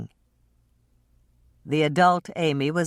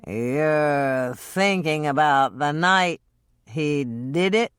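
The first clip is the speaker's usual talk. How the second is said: slurring; much slower than usual. Acted. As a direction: drag the words like a drunk. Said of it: "slurring his words".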